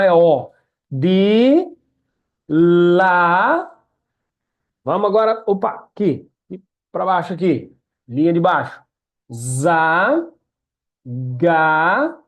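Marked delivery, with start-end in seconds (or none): none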